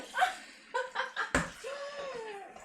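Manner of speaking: a high, bright burst of laughter that falls away. Real, not acted. Laughs after joking she only talked.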